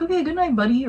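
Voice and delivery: mock sweet-talk to a pet; voiced goodnight to a pet. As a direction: say goodnight to a dog in a lifted voice. Man bids dog goodnight.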